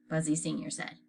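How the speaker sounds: ordinary delivery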